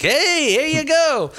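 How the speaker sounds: high, slow chirpy voice; chirpy offer. Retold or imitated. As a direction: sing it out cheerfully, high. Mock offering himself.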